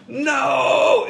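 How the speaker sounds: high, drawn-out distant wail; imitated scream. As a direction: mimic the cry from the woods. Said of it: "I heard it was like".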